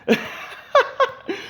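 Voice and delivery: breathless high giggle; wheezing laugh; high airless laugh. Real, not acted. Viewer laughing at the video, airless.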